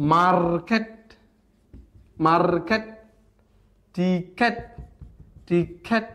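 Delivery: Market and ticket each said in two separated beats; split in two syllables. Shown as a demonstration. Teacher showing two-syllable words, each split in two. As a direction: break market, ticket after the first syllable. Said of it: "আমরা দুইটা দুইটা বলছি".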